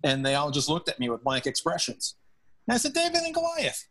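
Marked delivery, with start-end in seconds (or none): 3.0-3.8 surprised question. Retold or imitated. Quoting himself to blank players.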